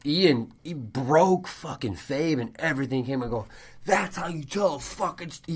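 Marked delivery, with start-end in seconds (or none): 3.8-5.5 wrestling promo. Retold or imitated. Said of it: "came out and cut a promo and goes".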